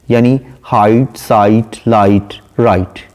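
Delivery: slow, each rhyme word set apart; height, site, light, right each said slowly with a pause between; rhyme words spelled out. Shown as a demonstration. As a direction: list the rhymes one by one, slowly. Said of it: "last word है height".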